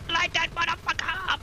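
shrieking battle cry; high-pitched yell. Real, not acted. Excited gamer yelling at target.

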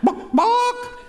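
high squawky chicken book. Acted. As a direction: cluck it like a hen asking. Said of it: "the chicken's back".

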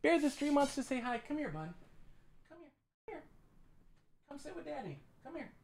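hushed high pet talk; coaxing puppy. Real, not acted. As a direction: lure the puppy onto your lap gently.

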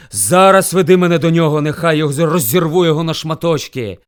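loud, threatening command; roaring angry bear. A narrator's reading. Bear orders hare to lead him, furious. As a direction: order with violent fury. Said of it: "ревів він".

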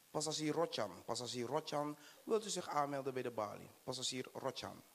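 soft airport desk announcer voice. Acted. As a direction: page the passenger like an airport clerk. Airport announcer paging passenger. (zachte omroepstem op het vliegveld)

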